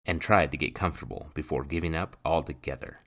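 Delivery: usual speaking voice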